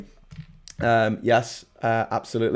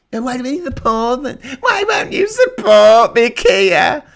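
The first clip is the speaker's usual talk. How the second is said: shrill, much higher shout, drawn out slowly; whiny Johnson parody; 10 semitones higher, 5 dB louder than usual. Pretend. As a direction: beg like a spoiled child. Whiny Johnson begging Keir for support.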